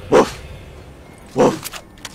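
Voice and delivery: short, punchy woofs; dog bark. Pretend. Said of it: "that god awful noise".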